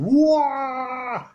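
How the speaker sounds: high-pitched kung fu battle cry; Bruce Lee yell. Retold or imitated. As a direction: let out a sharp martial arts kiai like Bruce Lee. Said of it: "pretending to be Bruce Lee".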